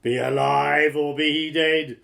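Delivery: loud, booming giant chant; giant's roar. A narrator's reading.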